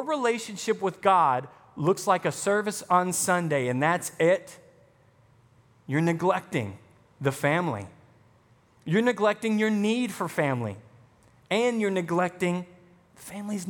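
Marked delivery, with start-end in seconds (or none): none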